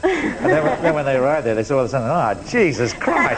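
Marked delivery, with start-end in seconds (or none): 2.1-3.4 slow, laughing broad Australian; slow Aussie drawl. Retold or imitated. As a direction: drag it out like a sunburnt convict. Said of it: "talking like that".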